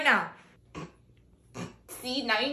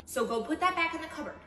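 ordinary talk

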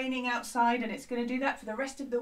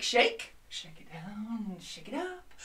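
ordinary delivery

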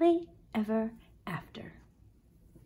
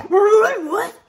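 loud, high startled shout; jolted awake; startled ogre; 11 semitones higher, 11 dB louder than usual. Acted. Ogre wakes with a start, loud.

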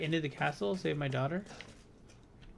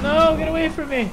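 panicked yell; 8 semitones higher, 7 dB louder than usual. Real, not acted. Startled by boss fight.

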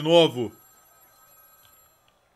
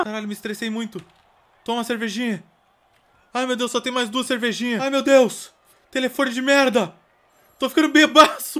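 high, loud frantic whining; a frazzled dad losing it over the phone and beer; stressed-out panic; 10 semitones higher, 7 dB louder than usual. Pretend. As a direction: spiral into a stress meltdown. Stress meter rising, panicking.

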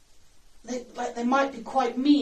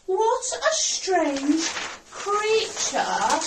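loud, slow, higher-pitched exclamation; shouting; 5 semitones higher, 6 dB louder, much slower than usual. Shown as a demonstration. Teacher showing how the jellyfish should not say it, shouting. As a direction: shout it out, stretching every word. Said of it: "don't want this jellyfish to shout out loud".

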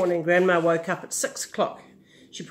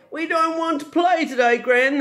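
howled; 7 semitones higher, 4 dB louder than usual. A narrator's reading. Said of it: "they howled".

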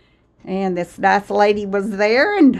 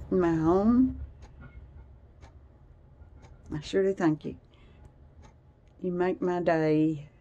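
softer, sincere thank-you to viewers; heartfelt thanks; 2 semitones lower, 7 dB quieter than usual. Real, not acted. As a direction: thank them gently from the heart.

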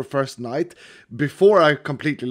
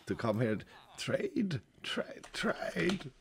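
hoarse, much softer, trailing into a whisper; croaking out the words with no voice left; ruined voice; 8 semitones lower, 14 dB quieter than usual. Real, not acted. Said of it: "Your voice was, like, completely ruined".

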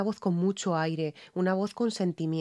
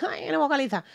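high whiny imitation of critics; mock complaint; 8 semitones higher than usual. Coach mimicking people who complain about diction. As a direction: whine it like a picky critic. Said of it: "Hay veces que me dicen".

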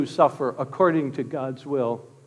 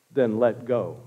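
God's voice; 6 semitones lower than usual. Acted. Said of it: "he hears a voice".

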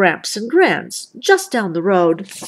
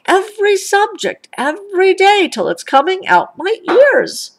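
higher, louder, building complaint; homework rant; 10 semitones higher, 4 dB louder than usual. A narrator's reading. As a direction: pile up each homework till it bursts. Brother rants about homework.

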